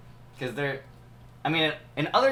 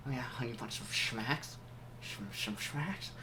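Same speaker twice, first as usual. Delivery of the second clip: creepy whisper; 3 semitones lower, 13 dB quieter than usual. Acted. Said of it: "That's terrifying".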